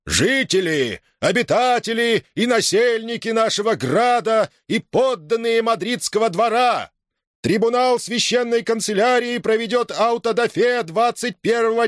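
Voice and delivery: high, resounding public proclamation; town crier. A narrator's reading. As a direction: call out the news like a herald. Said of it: "зычный голос глашатая разносил".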